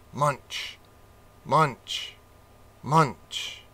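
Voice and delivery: spoken munch munch, in rhythm; munching sounds. Acted. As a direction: chew out each munch. Rat gnawing the boat.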